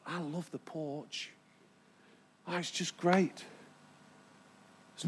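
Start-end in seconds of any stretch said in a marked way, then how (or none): 0.0-3.4 soft, low musing to oneself; slow, hushed savouring; contented private thought. Retold or imitated. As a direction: murmur it as a dreamy inner thought. Voices own contented thought on porch.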